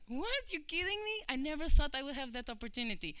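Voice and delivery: high squeal of disbelief; surprised disbelief. Retold or imitated. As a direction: voice jumps way up, can't believe the offer. Stunned by surprise job offer.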